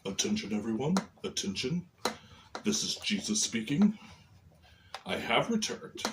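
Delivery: very low, quiet, far-off Jesus voice; deep Jesus voice. Acted. Puppet Jesus announcing his return to everyone, very low and quiet. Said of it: "I don't know why Jesus talks like this".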